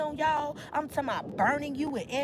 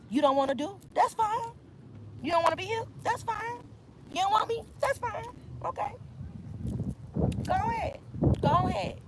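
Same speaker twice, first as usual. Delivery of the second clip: high sassy dismissal; 8 semitones higher than usual. Retold or imitated. Reader dismisses a leaving partner, high and sassy. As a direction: toss off each fine.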